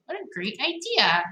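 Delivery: ordinary delivery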